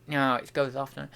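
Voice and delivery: usual speaking voice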